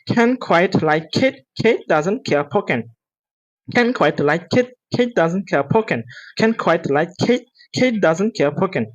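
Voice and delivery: connected words, no gaps; quick practice. Shown as a demonstration. Teacher shows students how to speed up, quickly. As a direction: run the sentence together briskly. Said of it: "দ্রুত বলার চেষ্টা করবেন".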